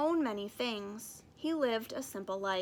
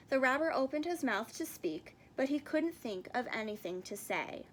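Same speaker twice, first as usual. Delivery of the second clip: ordinary delivery, nothing marked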